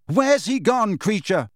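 high, loud, stress on Where's. Acted. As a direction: repeat it harder, losing patience.

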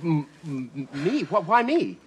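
stuttering, alarmed question; nervous stammer. Acted. Learning jealous husband wants his advice, nervous stammer. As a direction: trip over the first sounds in alarm.

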